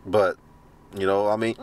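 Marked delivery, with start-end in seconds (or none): none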